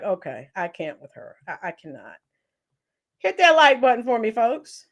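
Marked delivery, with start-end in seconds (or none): 0.0-2.2 much softer, lower, worn-out mutter; exasperated aside. Retold or imitated. Reacting to the girl crying then giggling, softly exasperated. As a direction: give up on her under your breath. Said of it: "I'm like".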